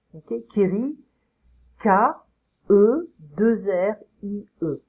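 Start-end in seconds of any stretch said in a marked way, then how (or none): 1.8-4.8 deliberate French spelling with spaces between letters. Shown as a demonstration. Teacher spells second name aloud.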